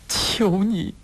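high, imploring, breaking off; pleading. A narrator's reading. Mother begs Wu for her child, pleading. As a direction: beg with almost no words left. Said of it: "满眼都是恳求的神色".

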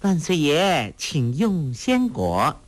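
high, drawn-out ceremonial offering; playful mock courtier. A narrator's reading. Girl kneels in play offering fruit to the emperor, mock-deferential. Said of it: "屈膝说道".

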